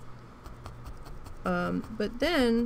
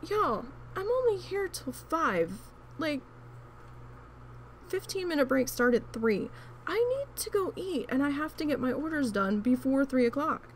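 exasperated complaint; 6 semitones higher than usual. Retold or imitated. To coworkers, needing lunch before break, high exasperated whine. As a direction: lay out the grievance like you've had it.

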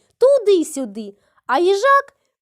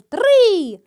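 a loud, higher start call; shouted go; 4 semitones higher, 4 dB louder than usual. A narrator's reading. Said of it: "Заєць крикнув".

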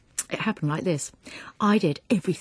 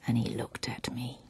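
ordinary delivery, nothing marked

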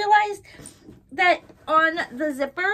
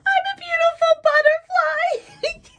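much higher and louder, teary and laughing; real happy sobbing; laughing crying; 7 dB louder than usual. Real, not acted. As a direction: wail the card's words through happy tears. Repeating the card's words while crying.

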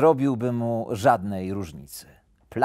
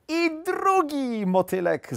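put-on high-pitched, drawn-out childish exclamation; high, gleeful squeal; excited little hare cry; 14 semitones higher, slower than usual. A narrator's reading. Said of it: "zakrzyknął na widok trzeciego".

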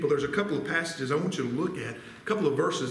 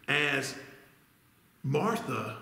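ordinary talk, nothing marked

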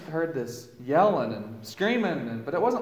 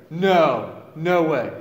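louder, flat-out disbelief; loud stunned refusal; incredulous denial; 9 dB louder, much slower than usual. Retold or imitated. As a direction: shoot the news down in disbelief.

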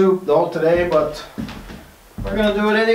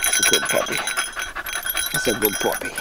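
whispered praise; 10 semitones lower, 6 dB quieter, faster than usual. Real, not acted. Whispered praise while hunting.

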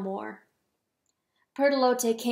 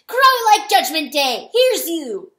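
loud, high rousing yell; buoyant cry; 6 semitones higher, 13 dB louder than usual. A narrator's reading. Weasel spurring on the crowing rooster, buoyant cry. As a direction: whoop encouragement up to the rooftop. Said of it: "the weasel cried buoyantly".

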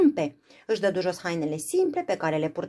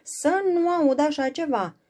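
firm refusal; 10 semitones higher, 5 dB louder than usual. Acted. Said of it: "răspunse hotărât negustorul".

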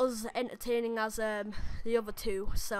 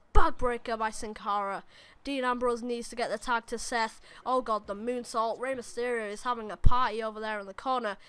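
hyped game commentary over the action; live play-by-play; 4 dB louder than usual. Acted. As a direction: call the match like a ringside announcer. Live commentary on his own match.

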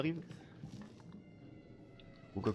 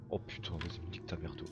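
usual speaking voice

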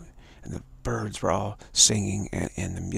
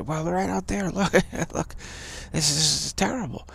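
insistent dreamer; 8 semitones higher than usual. Acted. Dreamer pointing at the mirage.

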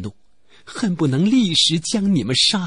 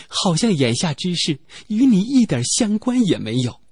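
plain reading voice

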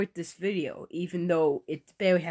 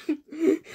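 high laugh; 10 semitones higher than usual. Real, not acted. Laugh after the bit.

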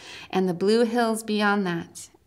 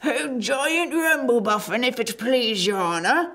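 humble giant voice; 3 semitones higher than usual. Acted. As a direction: play the simple, polite giant. Giant character voice.

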